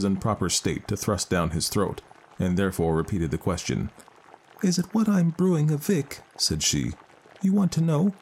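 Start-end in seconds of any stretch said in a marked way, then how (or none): 4.6-6.2 high, distressed Irish mother; grieving mother voice. Acted. Mother stalls the fairy. 7.4-8.1 grieving mother voice. Acted. Mother stalls the fairy.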